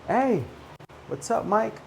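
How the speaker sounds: high, casual call to a friend; attention-grabbing. Shown as a demonstration. Teacher modelling a greeting to catch a friend's attention, high and casual. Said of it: "grabs the person's attention".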